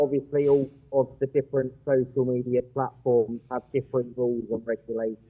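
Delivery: echoey, distant audio; sounding like in a cave. Real, not acted. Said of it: "He's, he's in a cave".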